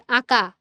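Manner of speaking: আঁকা with the first vowel sent through the nose; nasal vowel. Shown as a demonstration. Teacher showing students nasalisation, আঁকা with a nasal first vowel. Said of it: "তখন কিন্তু আমার নাসিক্য হয়ে আসছে".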